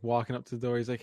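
usual speaking voice

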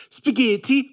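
"Spaghetti" said with exaggerated Italian sounds, pitched high; mock her over-Italian "Spaghetti"; affected Italian pronunciation. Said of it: "just the way she says".

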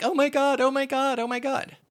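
very high, repetitive panicked wail; hammed-up hysterical chant; mock panic. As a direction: imitate a caller losing it completely. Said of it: "Or would I be like".

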